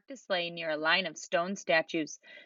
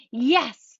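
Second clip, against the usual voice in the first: loud, higher cheer; eager shout; 6 semitones higher, 6 dB louder than usual. A narrator's reading. Kids answer Santa about seeing lions, shouting loud. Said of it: "they shouted".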